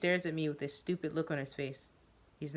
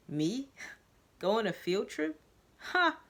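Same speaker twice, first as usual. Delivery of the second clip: mocking with a laugh, Ha! jumping high; taunting laugh; 6 semitones higher, slower than usual. Joseph mocking the field trip, laughing and taunting. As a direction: scoff at the idea. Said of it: "he taunts".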